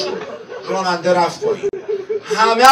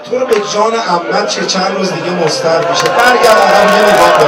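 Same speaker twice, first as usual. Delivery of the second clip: loud, higher pleading lament; impassioned sermon cry, louder and higher; full-force preaching; 5 semitones higher, 9 dB louder than usual. Acted. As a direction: cry out the oaths to the crowd.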